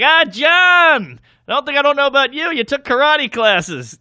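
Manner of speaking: high, loud mocking call-out; single out the boss, hammed up; comic roasting the CEO from the stage; loud roast jab.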